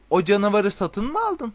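high-pitched, incredulous question; astonished. A narrator's reading. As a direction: ask it agape with disbelief. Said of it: "dedi Ron şaşkınlıktan bir karış açık ağzıyla".

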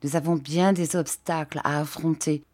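ordinary delivery, nothing marked

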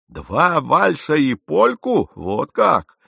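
loud, high, slow disbelieving repeat; drawn-out, scandalised question; incredulous echo. A narrator's reading. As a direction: throw his words back in mocking astonishment. Captain echoes the admission incredulously.

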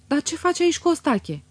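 sharp high challenge; indignant demand. A narrator's reading. Aglaie bursts in furious.

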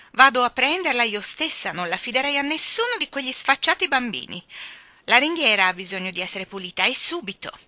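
high, loud booming lady. Acted. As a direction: boom it down the stairs like a bossy spinster. Miss Andrew bossy.

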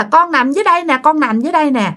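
put-on high, insistent child pointing; possessed child voice. Possessed woman insisting to divers, high childlike. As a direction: insist like a small child showing the spot. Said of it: "bà cứ chỉ là".

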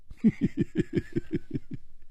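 high airy mocking laugh. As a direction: laugh at him to needle him. Mocking the silent man.

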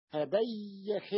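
"هبيخ" very slow, ha-bay-yakh; open ya demo. Shown as a demonstration. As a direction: hold the double y in "هبيخ". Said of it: "إذا الياء الأولى مفتوحة". (نطق "هبيخ" ببطء بياء مشددة مفتوحة)

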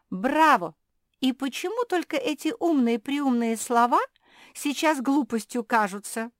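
high, loud sarcastic applause; cheer, then needle the listener; mocking praise. A narrator's reading. Jozi claps at Mafi's clever words, mocking her.

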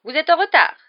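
high, loud professor's reprimand; shrilly disapproving teacher; reproving. A narrator's reading. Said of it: "d'un ton réprobateur".

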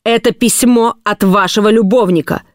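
heated cross-examination; stern interrogation. A narrator's reading. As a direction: press for a confession. Husband presses the accusation.

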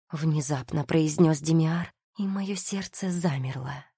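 ordinary delivery

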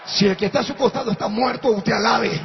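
loud, high, shouted sermon climax; rally the congregation at the top of the voice; full-force preaching. Acted. Pastor shouts praise and exhortation to the congregation, loud and high.

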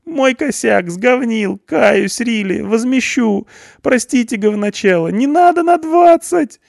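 very high, nasal-free whining youth; a scared punk pleading his case; whiny punk. A narrator's reading. Punk pleads with the policeman, high frantic whine.

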